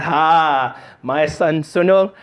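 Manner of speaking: slow, boastful Indian uncle; proud uncle on the phone, Indian accent. Acted. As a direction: brag word by word in an Indian accent. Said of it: "this is how they talk genuinely".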